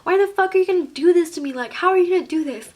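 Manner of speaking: high, loud, fast outburst; retold tearful yell. Crying girlfriend yelling at her boyfriend, retold yell. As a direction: cry out in hurt, reenacted protest. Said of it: "I was, like, yelling".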